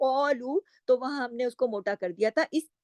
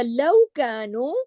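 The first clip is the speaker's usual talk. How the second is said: slow chanted लौ Kanu; recitation demo; 3 dB louder, much slower than usual. Shown as a demonstration. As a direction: chant it slowly.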